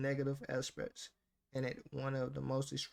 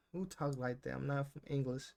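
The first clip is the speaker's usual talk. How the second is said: ordinary talk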